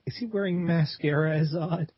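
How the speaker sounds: ordinary delivery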